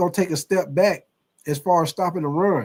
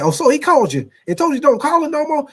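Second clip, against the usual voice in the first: much higher, loud disbelieving pundit; loud, higher incredulous pundit; Stephen A. Smith impression; 11 semitones higher, 6 dB louder, faster than usual. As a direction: act stunned and outraged like a TV host. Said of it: "Stephen A said".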